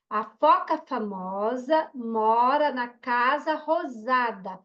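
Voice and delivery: slow, word-by-word reading; drawn-out, careful sentence reading; beginner reading aloud. Retold or imitated. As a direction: imitate a child reading a first book. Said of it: "o menino não foi lendo com dificuldade".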